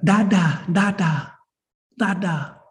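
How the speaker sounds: imitating a small child's bright call; toddler calling Dada. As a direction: call out like a toddler wanting a parent. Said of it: "this little human being that's nineteen months old".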